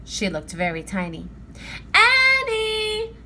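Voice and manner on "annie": high, loud sung-out call from far away; distant call. A narrator's reading. Mom calling her children from far off, sing-song. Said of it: "she called".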